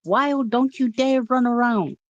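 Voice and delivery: high, drawn mock telling-off; put-on high-pitched reprimand; imitated scolding. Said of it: "I was getting yelled at".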